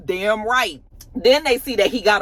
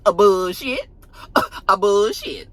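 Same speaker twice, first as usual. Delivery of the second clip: meme imitation; 3 dB louder than usual. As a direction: imitate the meme's "A bullshit" with a rising end. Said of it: "like that meme".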